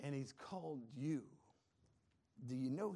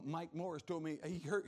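ordinary delivery

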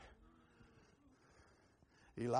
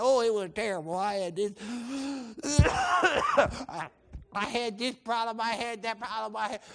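hammed-up blubbering complaint; badly faked sobs between the words; fake crying; 6 semitones higher, 7 dB louder than usual. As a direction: wail and cough through a mock breakdown. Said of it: "that was faking".

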